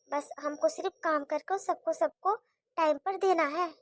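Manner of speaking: scolded daughter-in-law muttering a bitter aside; grumbling under breath. Acted. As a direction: complain quietly so the mother-in-law won't hear. Bahu grumbling aside after scolding.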